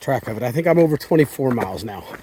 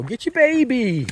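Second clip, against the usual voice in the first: coaxing an animal; 8 semitones higher, slower than usual. Real, not acted. Urging mother deer.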